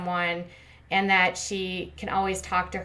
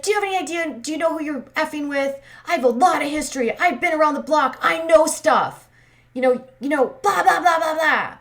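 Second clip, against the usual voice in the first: high-pitched imitation tirade, building louder; mock angry rant; 8 semitones higher, 5 dB louder than usual. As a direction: mimic her telling someone off, shrill and hammed-up. Acting out Lisa's angry confrontation.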